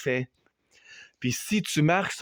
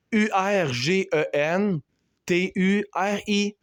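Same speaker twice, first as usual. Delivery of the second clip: spelled out slowly; 5 semitones higher, slower than usual. Shown as a demonstration. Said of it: "ça s'écrit comme ça".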